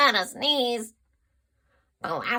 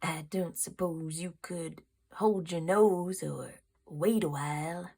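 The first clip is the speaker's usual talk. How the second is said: soft, lower, gently sad ask; sad crocodile; 5 semitones lower, 5 dB quieter than usual. A narrator's reading. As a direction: crocodile asks with a sad little smile. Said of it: "asked the crocodile with a sad little smile".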